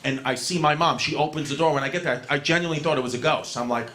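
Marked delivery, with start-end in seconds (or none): none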